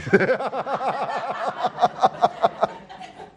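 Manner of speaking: real high laugh; high burst of laughter. Real, not acted. Preacher laughing at what his father answered. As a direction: let a big laugh out.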